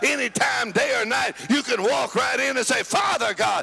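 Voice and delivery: rhythmic, building revival sermon; driving preaching. Real, not acted. Preacher tells congregation what the blood has done, driving rhythmic preaching.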